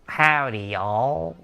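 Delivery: hammed-up Southern greeting; Southern accent. Shown as a demonstration. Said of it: "what accent am I throwing here".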